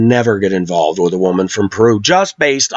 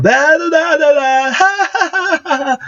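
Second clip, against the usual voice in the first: put-on much higher squealing baby babble; imitating baby talk. Wife babbling baby talk in the background, mock high squeal. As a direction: mimic her piercing baby-talk squeals. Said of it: "start talking baby talk and yelling".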